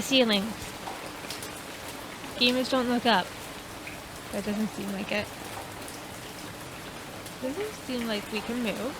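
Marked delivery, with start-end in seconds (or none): none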